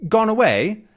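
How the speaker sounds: high, questioning character voice; deaf visitor's mishearing. Acted. Re-voices what deaf man heard.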